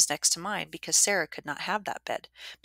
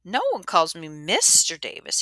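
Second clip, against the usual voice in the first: Davis's voice; 8 dB louder than usual. Acted. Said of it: "he told me yesterday".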